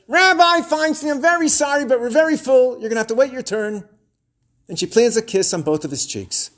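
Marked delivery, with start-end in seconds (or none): 0.1-3.9 receptionist aunt. Retold or imitated. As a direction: imitate an aunt announcing a long wait. Aunt receptionist addresses the rabbi.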